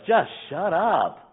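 high, loud, slow shut up; hammed-up divine scolding; mock God voice. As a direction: drag out a stern command from above. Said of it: "God's like".

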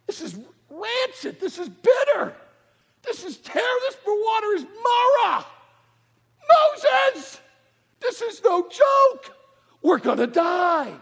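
high, loud mock panic; hammed-up wailing. Israelites crying to Moses that the water is bitter, mock panic.

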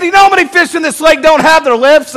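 loud, high comeback; defensive retort. Retold or imitated. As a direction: fire back loudly in mock defence. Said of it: "I said".

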